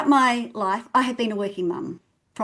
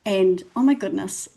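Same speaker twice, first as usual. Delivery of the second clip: ordinary talk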